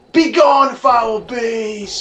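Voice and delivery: hammed-up heroic shout at the beast; theatrical warrior yell; mock battle cry. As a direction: bellow it like a storybook knight.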